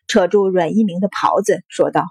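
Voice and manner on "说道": plain reading voice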